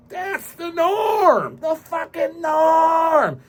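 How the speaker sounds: high, slow, stretched shout; drawn-out yell. Real, not acted. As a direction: drag out the norm in disgust. Ranting, repeating complaint.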